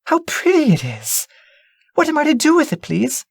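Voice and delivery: put-on high, eager little girl's voice; a child thrilled by a gift; delighted princess. Princess talks to old lady.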